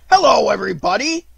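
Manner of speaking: high, loud bouncy greeting; cheerfully. A narrator's reading. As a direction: burst in with booming cheer. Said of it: "said Tigger cheerfully".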